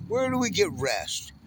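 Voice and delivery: normal talking voice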